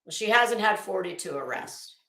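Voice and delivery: ordinary talk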